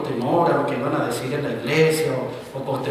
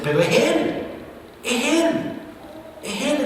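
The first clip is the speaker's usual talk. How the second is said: rising emphasis; 12 semitones higher, slower than usual. Real, not acted. Preacher lifting the church with rising Él. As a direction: lift each Él higher.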